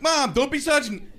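put-on high, exasperated kid protest; mock childish whine; whining back-talk. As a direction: complain at mom like a fed-up kid. Child talking back to mother.

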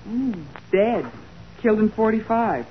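ordinary talk

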